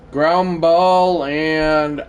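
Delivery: stretched, high-energy commentary; play-by-play call. Real, not acted. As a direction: call the ground ball with suspense. Calling the play as it happens.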